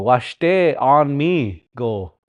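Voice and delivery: very slowly say "Washte on me go"; careful teaching pace; slow literal gloss. Shown as a demonstration. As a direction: lay out "Washte on me go" word by word. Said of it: "It's good".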